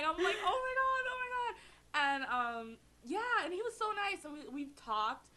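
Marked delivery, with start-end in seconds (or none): 0.4-1.5 high tearful gush, retold; mock crying. Fan retelling first meeting with her idol, mock crying. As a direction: imitate an overwhelmed, weepy "can't believe it". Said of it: "like, crying".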